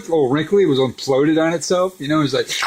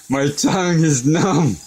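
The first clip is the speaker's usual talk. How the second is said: slow, higher mocking repeat; teasing echo; 4 semitones higher, 4 dB louder, slower than usual. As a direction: tease by drawing it out, a little higher. Mockingly echoes son's numb-tongue complaint.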